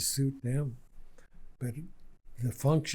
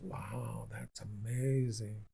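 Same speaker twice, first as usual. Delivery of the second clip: quiet wonder; 3 semitones lower, 7 dB quieter, slower than usual. Retold or imitated. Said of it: "I said".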